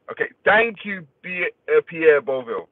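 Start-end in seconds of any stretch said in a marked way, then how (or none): none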